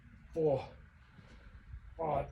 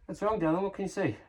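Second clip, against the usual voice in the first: high, soft-edged pet-name voice; sweetly pitched-up, caring question; tender concern; 8 semitones higher, 3 dB quieter than usual. Real, not acted. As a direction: check gently on a little darling. Breaks off to address darling.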